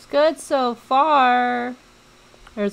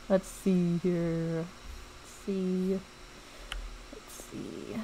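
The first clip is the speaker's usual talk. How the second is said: fading to whisper; 5 semitones lower, 12 dB quieter than usual. Real, not acted. Fading to whisper while searching.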